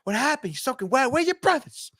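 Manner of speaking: high, soft, worried mom voice; a high, hushed mother's alarm; fretting mother. Retold or imitated. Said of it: "The mother".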